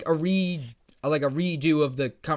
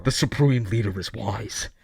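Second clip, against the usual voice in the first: hollow talking mask; 8 semitones lower, slower than usual. Pretend. Mock villain voice play.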